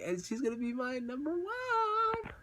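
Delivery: slow, soaring high build; dreamy climax. Retold or imitated. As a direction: let it climb into a long, high finish. Climax of dream before letdown.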